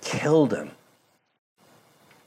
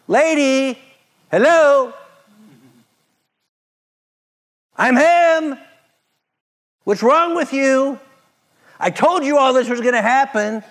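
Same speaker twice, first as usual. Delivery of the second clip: much higher and louder, exasperated; mock impatient Jesus; 13 semitones higher, 5 dB louder than usual. Preacher mocking a rude Jesus to the crowd, exasperated. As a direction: play it as a scolding know-it-all. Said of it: "That's not what he said".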